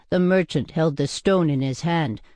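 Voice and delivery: ordinary delivery, nothing marked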